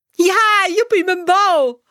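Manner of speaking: hoog en luid, dolblij kind; blij roepend. A narrator's reading. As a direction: juich het uit als een meisje dat haar bal vindt. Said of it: "roept Saar". (hoog, luid en dolblij uitgeroepen)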